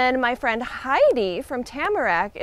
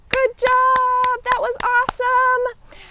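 excited praise; 9 semitones higher than usual. Real, not acted. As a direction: cheer them on. Praising kids after song.

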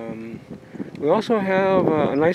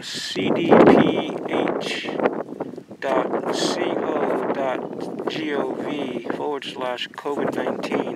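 spelling the address out letter by letter, slowly; slow dictation; much slower than usual. Real, not acted. Telling viewers where to find covid alerts, slow careful dictation. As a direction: read it slowly so viewers can type it.

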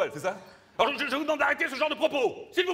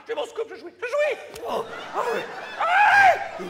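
high, laughing, overexcited cry; mock ecstasy; 10 semitones higher, 5 dB louder than usual. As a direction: squeal with fake pleasure. Host in mock ecstasy over scoop.

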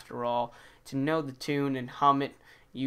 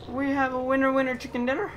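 triumphant; 11 semitones higher, 4 dB louder, faster than usual. Real, not acted. Victory celebration.

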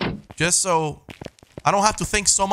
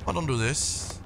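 scared horror game streamer; 8 dB quieter than usual. Real, not acted. Scared streamer in dark house.

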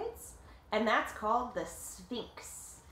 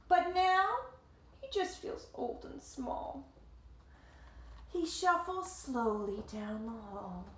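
story narration; 2 semitones higher, slower than usual. A narrator's reading. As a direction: read it gently as the cat feels old. Reading the picture book aloud.

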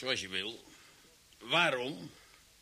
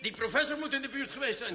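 much higher, urgent accusation; agitated; 10 semitones higher than usual. Acted. Officer insisting the professor was nearby, agitated. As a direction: blurt it out, worked up.

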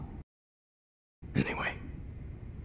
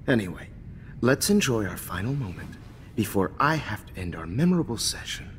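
normal talking voice

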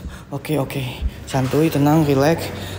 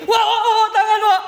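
high, breathless scared wahs with nervous laughter; panicked laughing yelps; 11 semitones higher than usual. Real, not acted. Panics after seeing a hand.

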